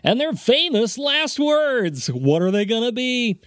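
slow, high, booming host build-up; game show host. Acted. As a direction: sell it like a TV quiz host. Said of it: "I sound like a fucking game show host".

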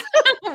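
loud, high burst of laughter; real laugh. Real, not acted. Laughing at why the hair works.

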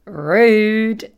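loud indignant one-word snap; a hammed-up indignant snap; mock offended. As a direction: act outraged in jest. Mock offence at staff reaction.

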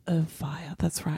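breathy whisper. Acted. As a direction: drop to a whisper. Sudden unvoiced drop.

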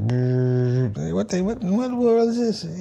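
high-pitched mock dad, baffled and scolding; imitating his dad. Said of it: "he's like".

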